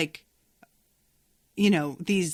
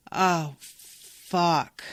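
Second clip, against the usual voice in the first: dismayed realization. Retold or imitated. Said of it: "commonly my reaction".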